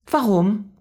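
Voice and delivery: put-on child's question. A narrator's reading. Child Mia asks.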